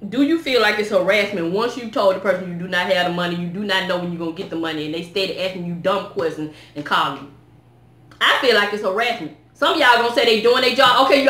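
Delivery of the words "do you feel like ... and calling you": softer, calm re-asking; calmer. Real, not acted. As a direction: settle down and ask it gently. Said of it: "let me calm down".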